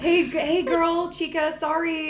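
high, fake-friendly apology; mock breezy voice. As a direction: chirp it like a casual text. Mocking the clinic's breezy apology.

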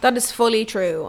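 ordinary delivery

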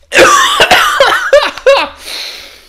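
loud, high burst of wheezy laughter; laughing fit with coughs; real coughing laugh. Real, not acted. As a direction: crack up helplessly after a failed impression. Failed impression sets off a fit.

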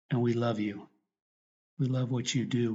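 normal talking voice

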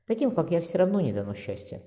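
usual speaking voice

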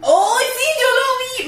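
excited. Real, not acted. Reacts to the Latin version.

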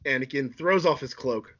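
usual speaking voice

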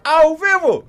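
high, loud hype call; excited shout. Real, not acted. Host hypes live broadcast.

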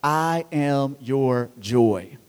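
slow soft I am your joy; tender, slow. Shown as a demonstration. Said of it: "four words that could just change".

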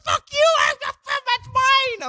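very high, loud, stretched-out shout; imitated angry yell. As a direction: holler after a thief, mimicking him. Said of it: "Is he yelling at you at all?".

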